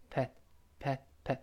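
short soft 'pat' for 'up at'; very light linking. Shown as a demonstration. Teacher joining p and at, very light little 'pat'. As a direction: flick 'pat' out lightly, barely stressed. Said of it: "非常轻啊".

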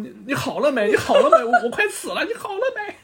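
high-pitched frantic asking, half laughing; hammed-up panic, pitch climbing; desperate plea. Vegeta begging Goku as he's nearly beaten, desperate.

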